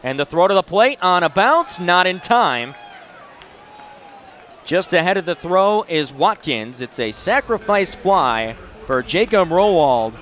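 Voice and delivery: usual speaking voice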